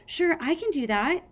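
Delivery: high-pitched, eager little dragon; brightened. A narrator's reading. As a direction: chirp a keen yes, pitched high. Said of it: "The smallest gold dragon brightened".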